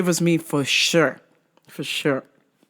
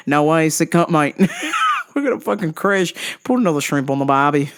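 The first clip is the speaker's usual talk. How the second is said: mock Aussie pilot. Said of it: "Aussie pilot".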